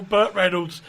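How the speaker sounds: ordinary talk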